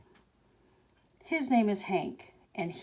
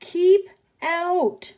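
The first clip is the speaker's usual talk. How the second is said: loud, higher, slow yell; 10 semitones higher, 8 dB louder than usual. Acted. Grumpy cactus yelling at the tortoise, loud and slow. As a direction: bellow it to drive them off. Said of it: "yelled Hank".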